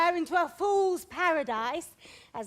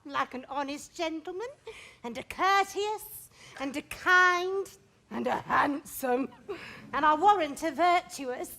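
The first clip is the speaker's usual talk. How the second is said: teasing; 3 semitones lower than usual. Acted. Nurse teases Juliet, dragging it out.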